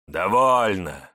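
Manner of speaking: slow, loud gloating command; villain voice. Acted. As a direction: bark it out, satisfied. Elder dismisses woman.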